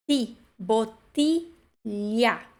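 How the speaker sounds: "bottiglia" very slow with a hard ti; hard double t. Shown as a demonstration. Teacher drills the double T, slow syllabic pronunciation. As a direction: isolate ti, then spell out "bottiglia" by syllable. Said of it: "mesmo que seja com dópia T".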